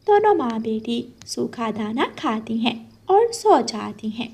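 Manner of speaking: plain reading voice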